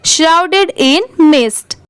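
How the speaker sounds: very slow, word-by-word reading; teacher demonstrating slow reading aloud; careful model reading. Shown as a demonstration. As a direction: read it out slowly for learners, pausing between words. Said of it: "यहाँ पर फिर से comma आया तो रुकिए".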